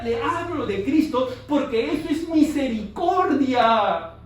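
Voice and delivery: full-force sermon on saving souls; fervent preaching. Acted. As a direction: preach it with urgency to the congregation. Preaching intensely.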